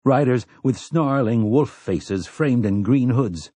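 ordinary delivery, nothing marked

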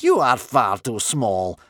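loud, slow grandmother's put-down; sceptical. A narrator's reading. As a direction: dismiss him slowly and loudly. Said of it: "grandmother was more sceptical".

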